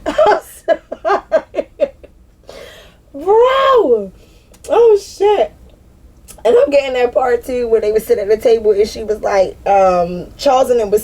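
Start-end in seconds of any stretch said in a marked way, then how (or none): 0.1-5.5 high, slow apology lost in helpless laughing; real laughter. Real, not acted. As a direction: gasp out sorry through tears of laughter. Still laughing at the play.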